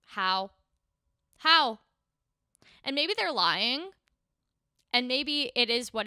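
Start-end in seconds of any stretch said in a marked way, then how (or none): 0.1-1.8 incredulous. Retold or imitated. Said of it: "And I'm like".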